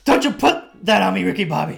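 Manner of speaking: loud high hammy movie-line shout; Talladega Nights quote. Retold or imitated. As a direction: yell it like a comedy movie. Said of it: "you know the reference? Talladega Nights".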